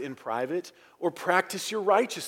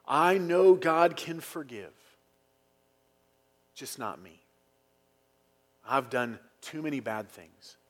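false humility; 2 semitones lower than usual. Retold or imitated. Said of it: "What do I mean by false humility?".

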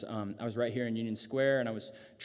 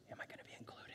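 very soft, whispered private worry; hushed, barely voiced question; whispered self-doubt; 20 dB quieter, much faster than usual. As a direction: whisper it like a secret question to yourself.

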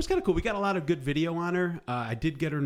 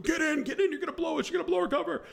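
high-pitched urgent mock yell; strained, high retold shout; faster than usual. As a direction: imitate a frantic yell without much volume. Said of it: "he's yelling at me".

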